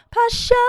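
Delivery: "Passion" sung high with a dropped, stretched first vowel; elongated vowel. Shown as a demonstration. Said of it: "dropping and elongating that vowel".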